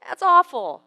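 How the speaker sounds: high, drawn-out, loud lament; mock regret. Burger eater with guilt pains afterwards, mock anguished regret. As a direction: wail in hammed-up regret.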